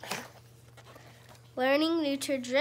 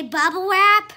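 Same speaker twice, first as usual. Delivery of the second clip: loud; 6 semitones higher, 12 dB louder than usual. Shown as a demonstration. Parent repeating the word for the child, loud. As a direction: bubble wrap called out loud and high. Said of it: "This time I say".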